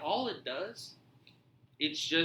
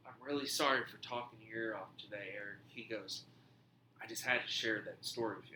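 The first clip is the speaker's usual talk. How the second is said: low, softened apology; retold apology; 4 semitones lower, 6 dB quieter than usual. The man apologizes for talking his ear off, low and subdued.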